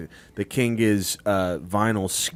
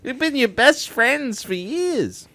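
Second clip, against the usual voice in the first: slow, much higher whiny plea; high pleading voice; slower than usual. Pretend.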